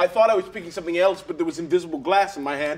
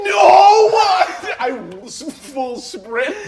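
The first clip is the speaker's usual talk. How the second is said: loud denial; 9 semitones higher, 8 dB louder, much slower than usual. Acted. Denying crying, loud.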